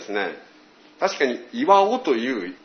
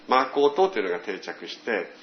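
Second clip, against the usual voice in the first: ordinary talk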